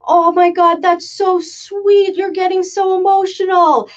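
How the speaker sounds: high-pitched, drawn-out imitation of a touched officer; mimicking an officer cooing over something sweet; gushing officer. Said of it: "The U S C I S officer goes".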